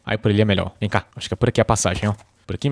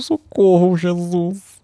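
mock panic; 7 semitones higher, much slower than usual. Real, not acted. Night falls in game.

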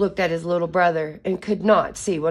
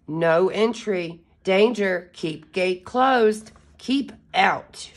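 sign reading; slower than usual. Acted.

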